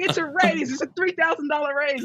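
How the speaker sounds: very high-pitched mock protest; retold defensive insistence. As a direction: plead the case to sceptical parents, hammed up. Defending low salary to shocked parents.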